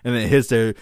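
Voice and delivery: usual speaking voice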